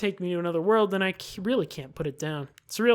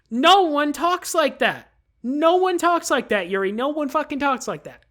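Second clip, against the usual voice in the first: ranting; 7 semitones higher, 8 dB louder than usual. Real, not acted. Gamer ranting at a character's fancy wording, louder. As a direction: hammer home that nobody talks like this.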